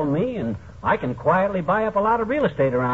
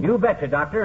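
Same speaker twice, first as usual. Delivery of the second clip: normal talking voice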